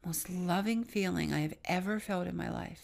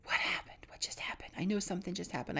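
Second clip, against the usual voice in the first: the friend's urgent hushed whisper; quick, breathless whispering; whispered question; 5 dB quieter than usual. Retold or imitated. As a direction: whisper as if in awe in a chapel. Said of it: "My friend Jaffa says to me".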